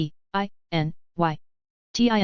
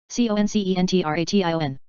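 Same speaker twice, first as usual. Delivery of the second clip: letters rattled off fast; quick spelling; faster than usual. Shown as a demonstration. Teacher spelling the word fast for the class. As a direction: run through the letters briskly. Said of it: "spelling drill".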